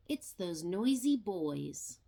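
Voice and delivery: plain reading voice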